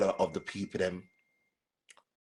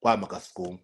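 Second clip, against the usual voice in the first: ordinary talk, nothing marked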